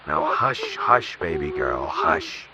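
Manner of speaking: low, soft hushing; calm her like a frightened child; soothing. Acted. Johnny calming terrified Peggy, soft low hushing.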